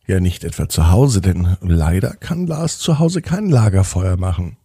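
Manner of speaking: usual speaking voice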